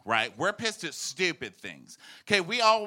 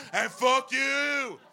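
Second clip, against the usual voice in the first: high, drawn-out kiss-off; defiant mock yell; 9 semitones higher, much slower than usual. Comic imitating Trump's last insult, defiant yell. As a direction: stretch it into a slow, hammed-up taunt.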